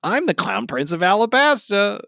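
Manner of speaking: high silly clown voice; Buggy the Clown. Pretend. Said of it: "it's me, Buggy the Clown".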